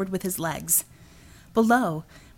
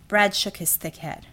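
ordinary delivery

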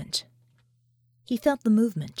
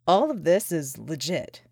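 unimpressed; 6 semitones lower than usual. A narrator's reading. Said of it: "He didn't look very impressed".

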